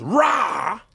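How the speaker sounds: British exclamation. Shown as a demonstration. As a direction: bark out a London rah. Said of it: "the other one that we're working on".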